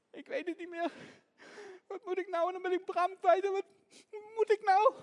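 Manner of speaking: put-on high-pitched distressed little girl; acted despair. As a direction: wail helplessly, at a loss which way to go. Lost child despairs at fork.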